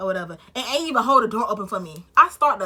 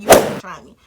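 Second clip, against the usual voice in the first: loud fed-up; 15 dB louder than usual. Retold or imitated. Fed up confrontation.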